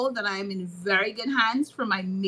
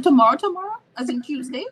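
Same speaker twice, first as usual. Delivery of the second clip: mock surprise; 5 semitones higher, 6 dB louder, faster than usual. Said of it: "I said".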